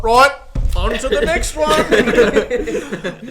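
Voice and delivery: mock announcement of the next wine; brisk moving on. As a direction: stretch it out, amused. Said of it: "it's like".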